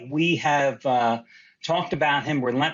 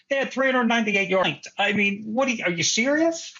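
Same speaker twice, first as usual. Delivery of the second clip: incredulous; 6 semitones higher than usual. Real, not acted. Mocking coach's claim.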